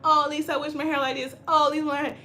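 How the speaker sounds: mock moaning over her own hair; whiny complaining client. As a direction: sigh out the complaint like a jealous client. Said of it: "coming here complaining like".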